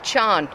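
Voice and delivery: "Sean" said as "chawn", for chewing; country "chawn" for chewing; Kerry pronunciation. Shown as a demonstration. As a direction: draw "Sean" into one syllable, "chawn". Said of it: "they say".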